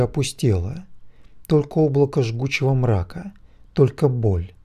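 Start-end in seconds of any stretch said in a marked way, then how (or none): none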